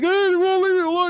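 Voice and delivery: very high falsetto wail, imitating a friend; squealed, hammed-up distress; mock freaking out. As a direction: play his helpless panic for laughs. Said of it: "He's, like, freaking out and shit".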